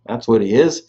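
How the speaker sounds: ordinary delivery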